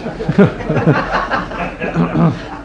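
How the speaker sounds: high-pitched, sustained chuckling; real laughter. Real, not acted. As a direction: laugh in amusement mid-session. Chamber laughs during debate.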